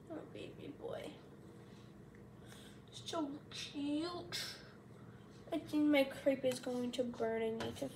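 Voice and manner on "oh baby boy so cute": tender pet talk. Real, not acted. Cooing quietly at the pet.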